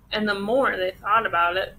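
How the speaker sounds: usual speaking voice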